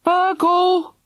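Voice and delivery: high, loud call. A narrator's reading. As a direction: call a name from far off. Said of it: "called Mister Love".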